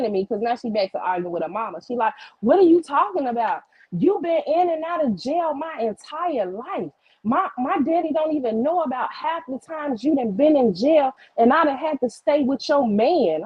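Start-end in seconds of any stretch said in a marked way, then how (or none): 2.4-13.4 imitating an indignant niece, higher voice; heated teenage comeback, re-enacted; retold argument. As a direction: argue back in raised, emphatic pitch. Acted niece arguing with her mother.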